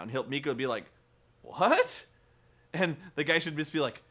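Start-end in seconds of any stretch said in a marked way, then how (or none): 1.4-2.0 baffled what. Retold or imitated. Mika baffled by the advice.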